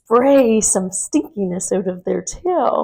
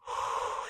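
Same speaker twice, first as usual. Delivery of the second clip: a slow breathy snore out through the mouth; snoring breath; 14 dB quieter than usual. Shown as a demonstration. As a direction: breathe out like a sleeping bee snoring. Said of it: "we're going to make a snoring sound".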